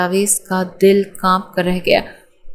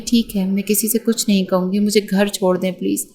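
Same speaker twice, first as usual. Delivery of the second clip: ordinary delivery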